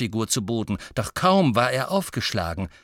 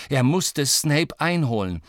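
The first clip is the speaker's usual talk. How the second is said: usual speaking voice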